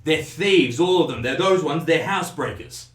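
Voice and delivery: put-on agitated drunk hurling accusations; angry yelled accusation. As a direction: denounce them at full volume. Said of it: "[name] is yelling".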